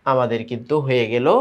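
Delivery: ordinary delivery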